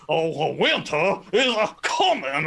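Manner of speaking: slow, drawn-out cartoon rooster impression; Foghorn Leghorn. Mocking the movie's Game of Thrones gag, cartoon rooster voice. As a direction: stretch it out like a blustering cartoon rooster. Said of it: "Foghorn Leghorn, I think his name is".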